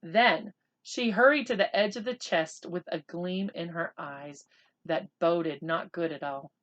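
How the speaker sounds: usual speaking voice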